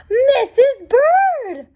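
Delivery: slow, loud joyful exclamation; surprised cry. Acted. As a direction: burst out the name in disbelief. Finds his lost wife.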